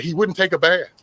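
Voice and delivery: indignant complaint. Retold or imitated. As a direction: insist loudly he wouldn't wash. Retelling jail quarrel to captain.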